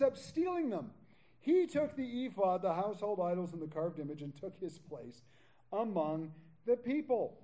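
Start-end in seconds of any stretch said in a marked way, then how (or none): none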